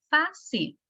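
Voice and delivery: first syllable of fácil high, falling on the second; énfasis en FA. Shown as a demonstration. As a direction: hit FA in fácil, then drop. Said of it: "su sílaba tónica en fa". (acento marcado en la primera sílaba de fácil)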